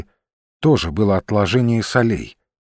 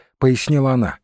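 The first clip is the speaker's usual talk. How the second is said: ordinary delivery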